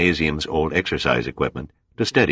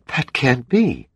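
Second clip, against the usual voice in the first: higher woman's voice, slow and stunned; disbelieving woman; 10 semitones higher, slower than usual. Acted. Dana told she is on the Titanic, stunned. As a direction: let the disbelief drag each word.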